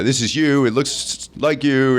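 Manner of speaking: retold cop, insisting with some hesitation; a little hesitant. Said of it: "they were a little hesitant".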